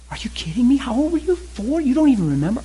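high, aghast questions; wife reeling at the news; stunned disbelief rising high; shocked wife. Pretend.

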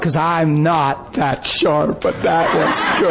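ordinary delivery

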